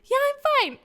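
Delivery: high-pitched, overly breezy reassurance; fake cheerful. Feigning being fine to mom.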